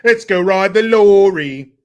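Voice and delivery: loud, slow British holler; mock British accent. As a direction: shout it in drawn-out fake British. Playing with British slang.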